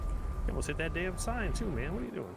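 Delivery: high, incredulous retold scolding; exasperated scolding. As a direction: play the alarmed driving instructor. Retold scolding of learner driver.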